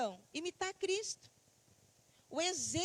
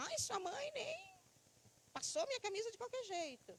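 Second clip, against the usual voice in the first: high-pitched imitated griping; put-on whiny grumble, pitched up; mock complaining; 7 semitones higher than usual. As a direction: mimic a husband moaning about his wife. Voicing a husband griping about wife.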